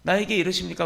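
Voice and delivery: high-pitched aggrieved protest; indignant complaint aimed up at heaven; protesting to God. Acted. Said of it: "항변하는 거예요".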